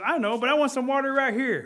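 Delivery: loud, high, impatient protest; insistent demand. Acted. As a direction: demand it on the spot. Rabbit argues with bear.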